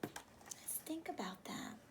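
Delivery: hushed whisper; soft stage whisper to the class. Acted. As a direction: whisper it like a secret.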